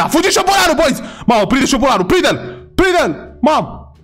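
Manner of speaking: high, excited cries to catch it; frantic chase calls. Pretend. Player to viewers as the rat escapes, frantic high calling. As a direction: urge everyone on after the runaway.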